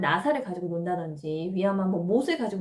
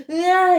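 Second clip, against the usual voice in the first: imitated toddler wail, much higher and loud; child's wail; 8 semitones higher, 11 dB louder, much slower than usual. Child scolded in a restaurant wailing back, loud wail. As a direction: burst into a tantrum cry. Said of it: "이렇게 돼버릴 수가".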